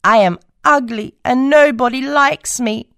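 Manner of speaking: 1 mild sob. Acted. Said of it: "sobs the ugly duckling".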